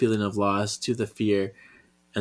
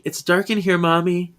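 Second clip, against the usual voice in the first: put-on higher child's voice calling for mommy; small child; 8 semitones higher, 4 dB louder than usual. Little girl calling to her mother from the darkness, higher child voice. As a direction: voice a scared little girl in the dark. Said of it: "we hear actual P J's voice".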